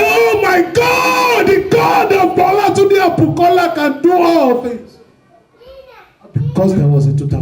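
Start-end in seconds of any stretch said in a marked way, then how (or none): 0.0-5.0 joyful exclamation. Retold or imitated. Exclaims praise in testimony.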